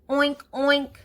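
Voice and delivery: high, loud snorty oink; piglet oink. Acted. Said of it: "Act like a piglet".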